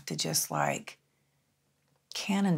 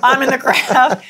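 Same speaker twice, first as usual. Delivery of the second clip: laughing; 9 semitones higher, 15 dB louder than usual. Real, not acted. Laughing admission.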